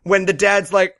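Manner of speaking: ordinary delivery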